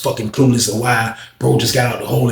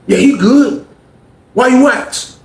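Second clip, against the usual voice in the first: higher voice, guarded and testing; Cliff's challenge; 11 semitones higher, 4 dB louder, slower than usual. Retold or imitated. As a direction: answer for him and dare the question. Cliff steps in.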